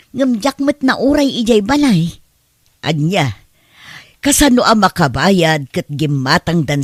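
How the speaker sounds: loud, heated scolding; angry loud rant. Acted. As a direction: rail against the debtor. Angry complaint about unpaid debt. (napigsa a pungtot)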